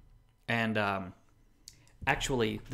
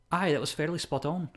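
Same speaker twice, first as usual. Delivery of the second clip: higher-pitched imitated Scottish approval; mock-Scottish accent, pitched up; 5 semitones higher than usual. Imitating Scottish viewers approving, Scottish accent. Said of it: "and they're like".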